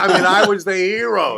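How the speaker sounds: loud, high laughing boast; laughing proudly. Real, not acted. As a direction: laugh through the brag. Proud story climax, laughing.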